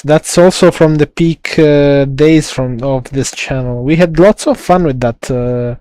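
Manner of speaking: ordinary talk, nothing marked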